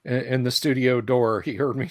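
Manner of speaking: ordinary delivery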